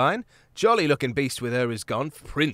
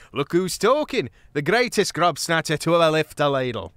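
higher, louder playful jab; teasing friend; 5 semitones higher, 7 dB louder than usual. A narrator's reading. Martin teases Gonf back, louder.

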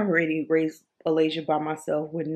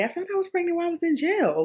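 rushed blurt; 12 semitones higher, much faster than usual. Real, not acted. Blurting the jail detail.

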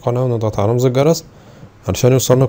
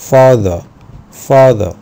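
father in a British accent, no final r, louder; 2 semitones higher, 8 dB louder, much slower than usual. Shown as a demonstration. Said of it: "في البريتش أكسنت ما بينطقوش ال إي آر".

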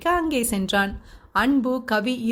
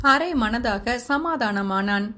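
ordinary delivery

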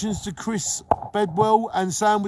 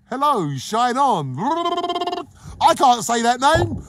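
excited greeting; 8 semitones higher, 7 dB louder, slower than usual. Real, not acted. Laughing over mispronounced name.

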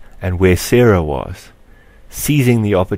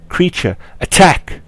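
commanding cry; 9 semitones higher than usual. Acted. Commanding his creature to attack.

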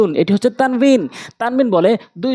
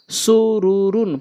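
drawn-out syllable by syllable reading; slow demo; much slower than usual. Shown as a demonstration. Teacher showing learners the word first, slowly. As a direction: stretch each syllable for learners. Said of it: "প্রথমে আমি slow বলছি".

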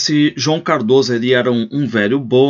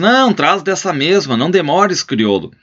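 higher and louder, brisk; hasty cover-up order; 7 semitones higher, 4 dB louder than usual. Acted. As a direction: brush off the problem loudly. Host covering up the shortage.

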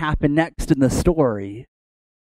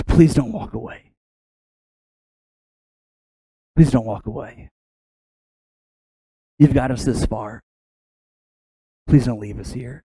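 hushed begging, sinking lower with long pauses; humble pleading; 3 semitones lower than usual. Acted. Moses on his knees before the Lord, acted humble pleading. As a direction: act out a subdued, spaced-out plea. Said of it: "on his hands and knees before the Lord".

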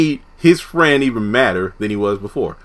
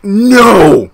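higher, louder shocked denial; 7 semitones higher, 7 dB louder than usual. Retold or imitated. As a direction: blurt it out in disbelief. Reacting to robe reveal.

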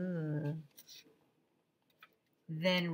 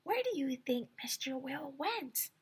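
softer, hushed question; whispered question; 8 semitones higher, 7 dB quieter than usual. Acted. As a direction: ask secretly while marching. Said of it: "he whispered".

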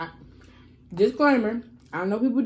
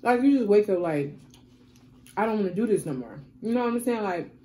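chewing food mid-sentence; talking while chewing. Real, not acted. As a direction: mouth partly full of food. Seen 2: Picks nachos from box; lips pressed, jaw working between words.

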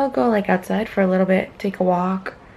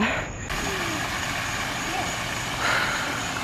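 long breathy sigh; 10 semitones higher, 8 dB quieter than usual. Real, not acted. Looking at the pretty view.

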